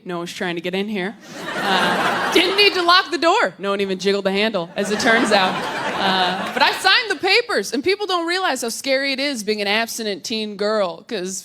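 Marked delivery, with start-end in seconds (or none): none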